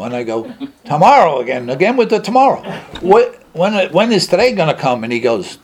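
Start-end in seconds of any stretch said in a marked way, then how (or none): 0.8-5.0 high, loud retold protest; fed up, pitch jumping up in disbelief; exasperated. Patient put off again about his promised drink, exasperated.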